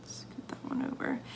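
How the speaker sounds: tense careful whisper; concentrating whisper. Real, not acted. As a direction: whisper while holding steady. Careful placing embeds.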